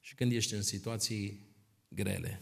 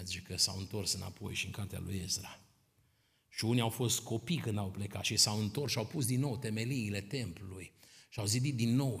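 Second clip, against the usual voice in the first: usual speaking voice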